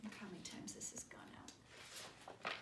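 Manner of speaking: hushed whisper; whispering aside. Real, not acted. Aside about library card.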